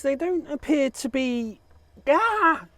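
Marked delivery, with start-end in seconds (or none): 2.1-2.7 high sudden "ah"; startled yelp. Real, not acted. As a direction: yelp as something goes wrong. Sudden surprise mid sentence.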